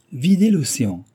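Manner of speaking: high, loud cry of enthusiasm; enthusiastic exclamation. A narrator's reading. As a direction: exclaim it, thrilled by the bold idea. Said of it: "s'écria-t-il". (s'écrier avec enthousiasme)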